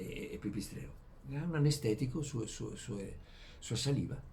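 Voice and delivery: ordinary talk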